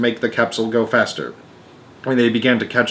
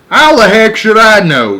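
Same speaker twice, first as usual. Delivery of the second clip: exasperated; 10 semitones higher, 9 dB louder than usual. Acted. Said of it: "said ground control".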